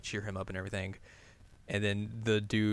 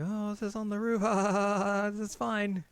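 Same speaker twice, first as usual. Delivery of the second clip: high, light put-on girl voice; put-on innocent girl; 10 semitones higher than usual. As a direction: pitch it way up, playing innocent. Acting out her cover-up in the roof scene.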